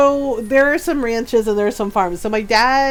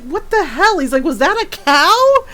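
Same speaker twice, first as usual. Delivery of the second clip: baffled; 6 semitones higher, 3 dB louder than usual. Retold or imitated. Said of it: "he's just like".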